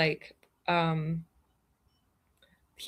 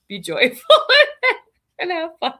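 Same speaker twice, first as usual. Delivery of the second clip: laughing through words; 10 semitones higher, 8 dB louder than usual. Real, not acted. Laughing while praising husband.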